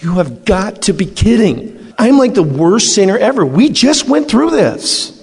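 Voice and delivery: mock-shocked pleading with Jesus; incredulous protest.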